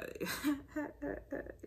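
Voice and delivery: soft, high chuckle; laughing. Real, not acted. Reader amused that someone is mad, chuckling. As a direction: let a quiet giggle run on.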